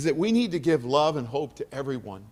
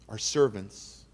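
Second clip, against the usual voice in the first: ordinary talk, nothing marked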